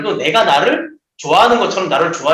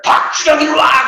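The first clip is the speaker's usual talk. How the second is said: higher, louder call across the barracks; summoning shout; 8 semitones higher, 4 dB louder than usual. Retold or imitated. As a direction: bark the name out to summon him. Senior shouts for the corporal.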